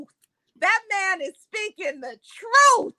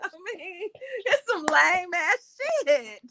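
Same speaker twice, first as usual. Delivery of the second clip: laughing; 4 semitones higher, slower than usual. Real, not acted. Laughing at the purse story.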